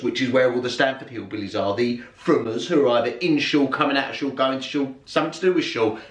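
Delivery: usual speaking voice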